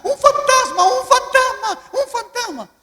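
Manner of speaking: panicked high-pitched scream of alarm; terrified shout. Retold or imitated. Fishermen spotting a figure on the sea, terrified shout. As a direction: cry out at full volume, very high. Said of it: "empiezan a gritar".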